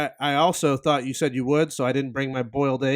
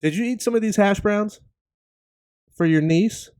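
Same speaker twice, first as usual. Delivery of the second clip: imitating his wife; 6 semitones higher than usual. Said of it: "She's gotta add that too".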